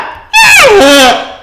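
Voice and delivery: shrieking burst of laughter; real loud delighted laugh; loud high laugh. Real, not acted. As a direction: let a big laugh rip.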